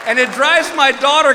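ordinary talk, nothing marked

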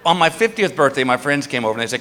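ordinary talk, nothing marked

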